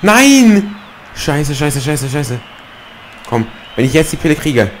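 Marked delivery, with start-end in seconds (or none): 0.0-2.4 panicking gamer. Real, not acted. Gamer loses the ball.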